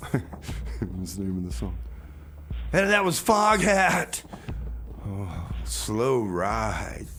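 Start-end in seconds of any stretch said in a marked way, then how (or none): none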